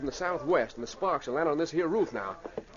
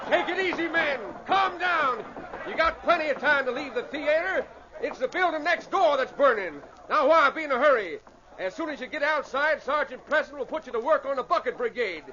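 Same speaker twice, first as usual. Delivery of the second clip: calling out; 8 semitones higher than usual. Acted. Calming a panicking theater crowd.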